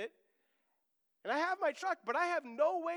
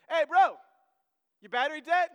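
a loud friendly call across the lot; helpful stranger shouting over at the gas station; 6 semitones higher, 13 dB louder than usual. Retold or imitated. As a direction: hail a stranger from a distance.

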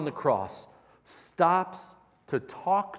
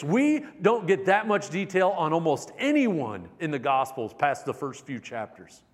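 ordinary talk

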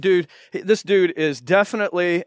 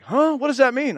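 confused huh; 9 semitones higher than usual. Retold or imitated. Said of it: "they're like".

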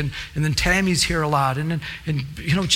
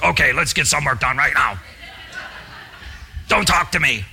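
grumpy worker. Acted.

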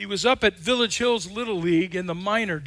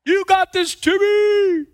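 high, loud cheering from the stands; loud proud cheer; 10 semitones higher, 5 dB louder, slower than usual. Retold or imitated. Grandma cheering Timmy at bat, high loud cheer. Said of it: "oh, loud and proud".

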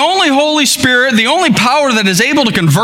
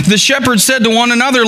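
ordinary talk, nothing marked

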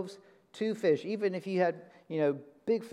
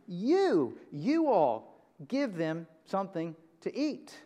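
emphatic "You", then spaced-out words easing off; stress on YOU; 2 semitones higher than usual. Shown as a demonstration. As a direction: hammer "You", pause, then "you all". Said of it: "That's the emphasis in the language".

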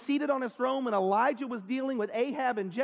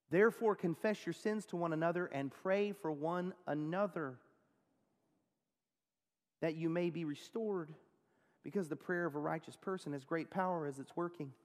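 soft scripture reading; 4 semitones lower, 7 dB quieter than usual. Acted. Reads scripture softly.

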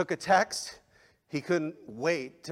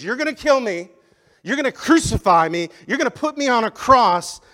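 declaring Jesus; 9 semitones higher, 13 dB louder than usual. Acted. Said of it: "Jesus points to them and says".

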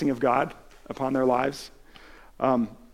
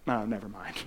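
a softer, lower, defeated "Oh, never mind"; dejected give-up; 4 semitones lower, 8 dB quieter than usual. Retold or imitated. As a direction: let it drop, beaten before trying. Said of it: "I'm like".

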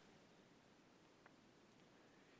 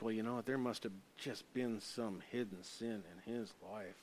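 hushed mock gossip; 5 semitones lower, 11 dB quieter than usual. Imitating gossiping onlooker.

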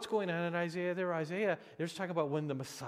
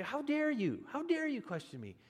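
imitated indignant "how dare you", pitched much higher; a hammed-up outraged God scolding John; preacher voicing an imagined angry rebuke; mock angry rebuke; 11 semitones higher than usual. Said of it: "instead of just being angry with you".

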